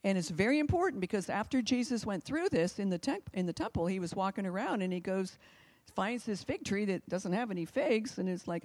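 ordinary delivery

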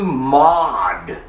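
MAD drawn out with a British broad A; British accent. Acted. Festus tells Paul he's mad, in a British accent. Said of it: "didn't say it in a British accent".

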